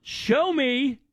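ordinary talk, nothing marked

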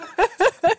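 a bright, high burst of laughter. Real, not acted. Laughing while telling friends about the bunk bed. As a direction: laugh at the happy memory.

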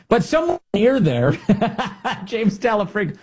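cracking up, high-pitched; high, tickled delivery breaking into laughter; laughing. Real, not acted. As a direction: laugh through the words. Host cracking up at own joke.

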